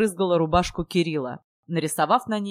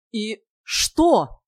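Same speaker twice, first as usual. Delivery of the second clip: higher, louder plea; pleading; 4 semitones higher, 6 dB louder than usual. A narrator's reading. Hero begs his beloved to respond, raised pleading voice. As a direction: beg her for an answer. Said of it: "взмолился Кирилл".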